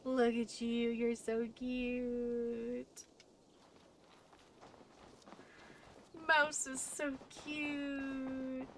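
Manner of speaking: cooing at a pet. Real, not acted. Cooing at the dog.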